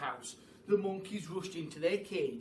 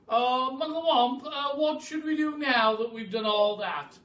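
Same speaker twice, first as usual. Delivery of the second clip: calling out; 8 semitones higher, 10 dB louder than usual. Acted. Tired bird calls out.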